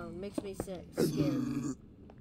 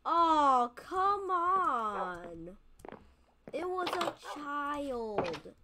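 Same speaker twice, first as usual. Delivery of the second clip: loud groan easing into a slow lament; long, high, anguished complaint; dismayed wail; 5 semitones higher, 5 dB louder, much slower than usual. Real, not acted. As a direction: protest in drawn-out dismay. Dismayed after hitting baby.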